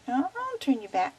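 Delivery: usual speaking voice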